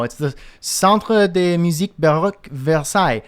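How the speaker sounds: hammed-up French pronunciation of the name; a self-mocking stab at a French accent. Shown as a demonstration. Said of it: "butchering of the French accent".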